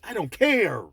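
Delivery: loud, high, exasperated outburst; emphatic dismissal. Real, not acted. Man rebuffing complaints about hurt feelings, emphatic dismissal. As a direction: blast it out, landing hard on care. Snaps back at mocked complaint.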